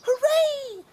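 very high loud happy chick; cheering chick. Acted. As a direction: squeal it for joy. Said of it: "cheep little chick".

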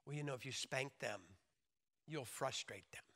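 imitated mild-mannered disapproval; mock objector. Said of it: "I've had people say this".

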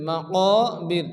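"মাকাবির" with long, rounded "কা"; teacher's tajweed "মাকাবির"; elongated vowel. Shown as a demonstration. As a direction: stretch the middle of "মাকাবির". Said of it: "এক আলিফ পরিমাণ টান দিয়ে".

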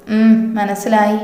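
slow sulky agreement. Acted. Sulky reply to husband's scolding.

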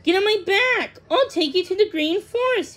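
high, loud, urging bird voice; eager offer. A narrator's reading. The swallow offers Thumbelina a ride, eager. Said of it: "he offered".

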